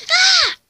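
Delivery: loud high shriek; scream. Acted. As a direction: shriek in panic. Cat scared.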